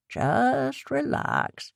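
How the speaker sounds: slow, drawn-out calming words; soothing. Acted. Lucky calming Abby after she smashes the fridge, slowly. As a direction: ease her down slowly and gently.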